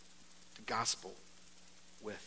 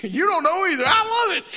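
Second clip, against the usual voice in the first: amused; 13 dB louder than usual. Real, not acted. Laughing at a correction.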